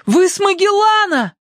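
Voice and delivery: very high-pitched excited shout; boy's cry. A narrator's reading. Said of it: "мальчик крикнул". (мальчишеский крик, очень высоко)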